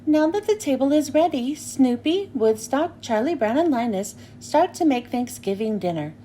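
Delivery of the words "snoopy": ordinary delivery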